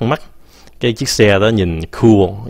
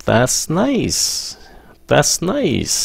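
rising, upbeat; 6 semitones higher, 4 dB quieter, much slower than usual. Shown as a demonstration. Teacher showing students a pleased, high That's nice. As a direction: pitch lifted high on nice, bright and pleased. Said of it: "Nhớ nhau lên giọng nha".

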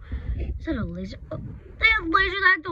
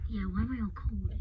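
whisper; 5 semitones lower, 6 dB quieter, faster than usual. Real, not acted. Repeating a question, whispered.

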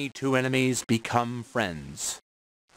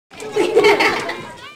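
high, loud breathy laugh; a sudden amused laugh out loud; burst of laughter; 9 dB louder than usual. Real, not acted. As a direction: crack up at the joke. Narrator laughs at own line.

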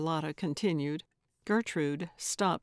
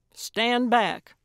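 loud, raised-pitch warning; warning command; 4 semitones higher, 6 dB louder than usual. A narrator's reading. Gertrude warns before swinging the hammer, commanding. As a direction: order them clear of the swing.

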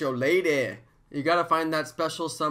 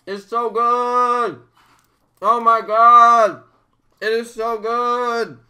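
slow, loud, higher ecstatic praise; ecstatic moan; 7 semitones higher, 9 dB louder, much slower than usual. Real, not acted. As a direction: savour it, overwhelmed with delight. Ecstatic over snack.

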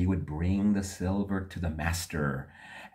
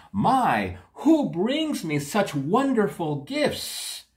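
wondering king; 11 semitones higher than usual. Acted.

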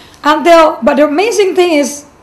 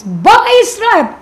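voice from far away; 7 semitones higher, 4 dB louder than usual. Retold or imitated. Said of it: "speaking like this".

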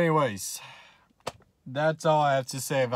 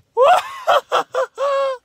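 very high, loud gleeful screaming laugh; shrieking laughter; 7 dB louder than usual. Real, not acted. Tennessee fan cackling at Alabama's loss, shrieking laughter. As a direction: laugh like you can't believe your luck.

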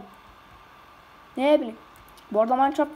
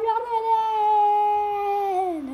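mock wail; 6 semitones higher, much slower than usual. Stuck, last cry for help.

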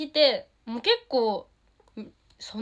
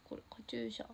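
whisper; 12 semitones lower, 18 dB quieter, faster than usual. Real, not acted. Showing her headband quietly.

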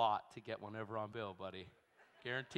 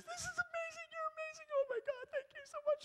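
much higher, gushing thanks; imitated gushing. Imitating the waitress gushing thanks at the table. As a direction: gush like an overwhelmed waitress.

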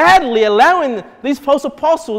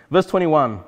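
usual speaking voice

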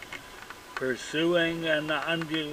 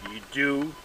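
plain reading voice

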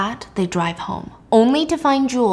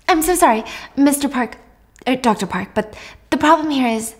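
raised, anxious apologetic teacher voice; a flustered teacher, pitched up and eager to please; nervous teacher; 4 semitones higher than usual. Retold or imitated. Teacher apologising to stern parent.

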